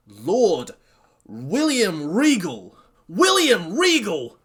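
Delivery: loud, drawn-out big reveal; awestruck announcement. Real, not acted. Fan announcing Regal's surprise entrance, thrilled slow shout.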